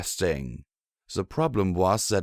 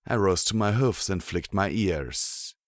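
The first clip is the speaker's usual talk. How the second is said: ordinary delivery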